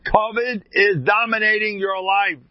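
high, sustained acted shout; retold yell. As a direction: hammer it home as a yelled rebuke. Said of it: "he yells at him".

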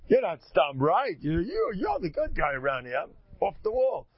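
high-pitched, emphatic self-blame; hammed-up, insistent admission of fault; screamed concession. Shown as a demonstration. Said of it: "I scream".

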